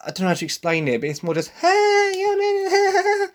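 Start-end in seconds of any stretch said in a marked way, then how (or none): 1.5-3.3 squealing high mock American girl, loud; shrill sitcom teen squeal, hammed-up; over-dramatised American teen. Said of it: "like when America's over-dramatise".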